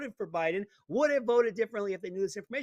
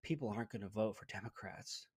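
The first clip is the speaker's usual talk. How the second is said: much lower and softer, a hushed mock-frightened pundit; mock scared; 11 semitones lower, 13 dB quieter than usual. Imitating a scared leftist pundit, hushed and mock-frightened. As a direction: whisper it like a stunned talking head. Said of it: "flabbergasted and almost scared".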